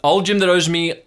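high, loud uncle voice; blustering uncle. Acted. Uncle explains old Jim's debt to his nephew, high and blustering. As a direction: insist on a dubious story, loudly. Plays Uncle Marv on the phone.

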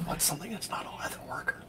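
whispered aside to oneself; thinking aloud. Real, not acted. Co-host puzzling over a character's job, whispered to himself. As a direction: whisper it while searching for an idea.

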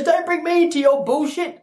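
very high imitation of a fed-up wife; mock rebuke. Wife refusing to share the blame, high mock rebuke.

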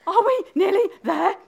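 high, out-of-breath question; panting. Acted. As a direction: gasp it out as an exhausted runner. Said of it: "managed to pant at last".

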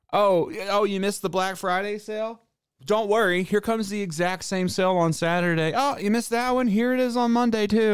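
mock a pushy retailer cheerily; mock salesman. Vlogger mocking endless repeat sales, in a high sing-song sales voice. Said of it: "as a company, being like".

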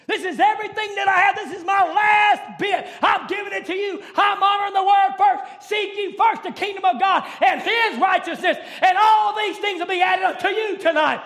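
loud, impassioned sermon exhortation; preaching at full force. Acted. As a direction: plead with the church, raising the voice. Preacher pleading to congregation.

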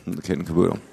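low, mock-serious repeat; deadpan mock-theological; mock solemn. Shown as a demonstration. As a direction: say it like a grave technical term. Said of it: "that's a theological term".

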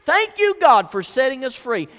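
high, loud mock rejoicing; hammed-up shouts of thanks; jubilant praise. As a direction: cry out freedom, acting a liberated crowd. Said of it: "Man, singing praises".

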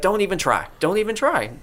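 high, curt brush-off; mock snippy high voice. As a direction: snap it off high and dismissive. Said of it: "he's just like".

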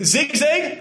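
high incredulous Zigzag?; mock surprise. Friends pouncing on his Canadian word, incredulous. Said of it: "And like".

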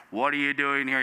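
a low, grave question; low voiced question. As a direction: ask it deep and grave. God's question replayed.

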